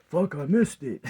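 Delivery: loud, high cry of frustration, re-enacted; retold exclamation. Man re-enacts missing it as a kid, loud exclamation. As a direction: act out yelling at a missed shot. Retelling a childhood mishap, exclaims.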